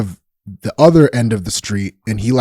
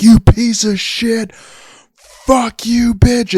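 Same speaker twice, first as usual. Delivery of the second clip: yelling out the window; 10 semitones higher, much slower than usual. Retold or imitated. Neighbor yelling down at an abuser in the street, high furious yell. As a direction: shout it down at him from the window.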